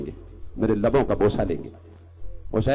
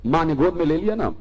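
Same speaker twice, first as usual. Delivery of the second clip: usual speaking voice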